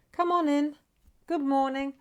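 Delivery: high, loud chanted greeting; lilting high call-in, notes held level; sing-song welcome. Real, not acted. Calls arriving viewers in.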